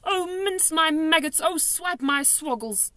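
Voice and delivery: high, then slow wailing curses; dismayed oaths. A narrator's reading. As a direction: swear in despair.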